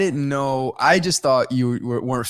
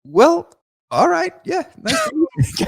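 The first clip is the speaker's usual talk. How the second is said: awkward polite brush-off; 5 dB louder than usual. Retold or imitated. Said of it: "and then he goes".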